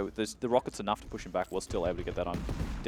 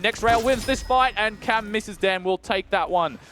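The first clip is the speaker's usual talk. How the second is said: high, loud, slowing at the decisive shot; esports caster; 8 semitones higher, 7 dB louder than usual. Real, not acted. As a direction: stretch out the winning moment. Caster calls the final rail.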